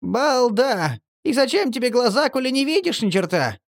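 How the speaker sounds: very high squeaky scolding. A narrator's reading. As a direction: snap at him, squeaking. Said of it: "выругался Марли".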